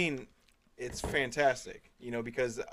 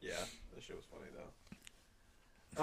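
whispered aside; 6 semitones lower, 18 dB quieter, slower than usual. Real, not acted. Unvoiced comment.

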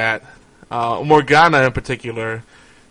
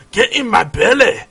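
put-on loud-mouthed, higher-pitched comic Scottish accent; exaggerated Scottish impression, pitched up; mock Scottish accent; 7 semitones higher, 3 dB louder than usual. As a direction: belt out a hammy Scottish catchphrase. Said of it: "the go-to".

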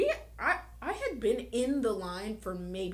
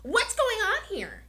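mock customer voice; 11 semitones higher, 4 dB louder than usual. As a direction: mimic an indignant drive-thru customer, pitched way up. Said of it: "It's the voice".

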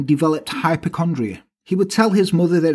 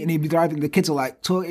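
ordinary talk

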